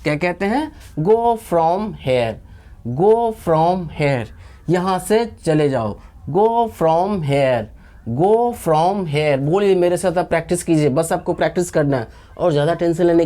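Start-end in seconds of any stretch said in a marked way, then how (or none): 1.0-4.2 slow, evenly spaced words; slow drill. Shown as a demonstration. Teacher asking learners to practise along, slow drill. 6.3-9.4 slow, evenly spaced words; slow drill. Shown as a demonstration. Teacher asking learners to practise along, slow drill.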